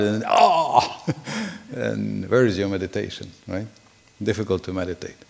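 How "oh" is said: playful "oh!" as if pinched; comic yelp of pain; mock cry of pain. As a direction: act out a sudden pinch with a drawn-out oh. Acts being pinched while meditating.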